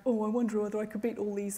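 fast, bloke-ish lad voice; laddish voice. Acted. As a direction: play a cocky young lad. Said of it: "I'm doing that laddish voice".

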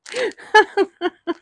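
very high, squealing giggle. Real, not acted. Vlogger giggling at her own rise up lights joke, high squeal. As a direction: squeal with silly laughter.

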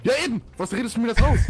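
lauter, höher empörter Ausbruch; empört aufbrausen, als würde man verraten. Acted. Gespielter Streit, empört. (empörter Aufschrei im Rollenspielstreit)

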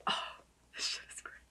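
hushed delighted whisper; soft awed whisper. Real, not acted. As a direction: whisper it like a secret thrill.

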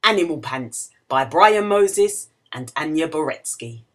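ordinary delivery, nothing marked

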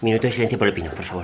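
mock solemn. Mock solemn call for silence.

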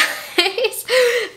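short high giggle; a quick high-pitched laugh. Real, not acted. Laughs after apologizing.

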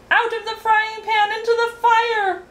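high, loud storybook shout. A narrator's reading. Count burning himself, shouting out high and loud. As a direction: cry out at a sustained high pitch. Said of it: "shouted the count".

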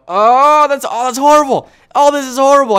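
high, loud cry of pain; pained outcry. Real, not acted. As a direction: wail through the sting. Clothespin hurts on his lip.

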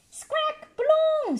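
very high squawky parrot. Acted. Polly the parrot squawking balloon. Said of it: "says Polly Parrot".

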